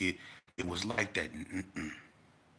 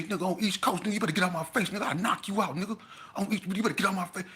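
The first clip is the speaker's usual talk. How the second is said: mock threat; 10 semitones higher, faster than usual. Cali boy threatening Baby Boy.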